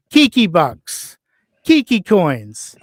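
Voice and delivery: drawn-out, mocking names; slow mocking emphasis. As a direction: stretch each name slowly, scoffing. Mocking her branding, drawn out.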